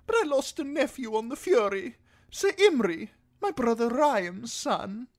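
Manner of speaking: high, loud highborn lord; Lord Alistair. A narrator's reading. Lord tells Davos of his lost nephew, self-absorbed. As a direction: recount it in a high aristocratic voice.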